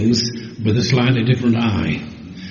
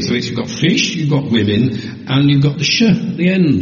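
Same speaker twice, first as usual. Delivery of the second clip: usual speaking voice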